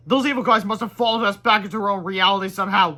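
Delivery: ordinary delivery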